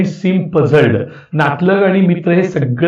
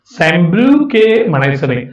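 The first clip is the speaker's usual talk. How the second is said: usual speaking voice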